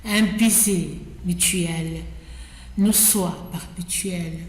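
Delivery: measured poem reading with pauses between phrases; poetry recitation. Acted. Reader recites Ronsard's ode to Cassandre, measured poetic recitation. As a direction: recite it line by line, giving each phrase room.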